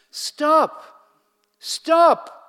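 loud high breathless call. Acted. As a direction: shout after him, out of breath. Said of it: "she calls him, almost out of breath".

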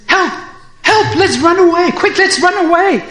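high, loud cry of panic; terrified. A narrator's reading. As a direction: urge everyone to flee in sudden terror. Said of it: "He suddenly becomes terrified".

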